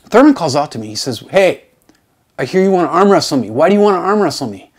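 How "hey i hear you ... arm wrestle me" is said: loud, fast challenge; confronting call. Retold or imitated. Colleague confronting him about the arm wrestling, loud and fast. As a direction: call him out across the courtyard.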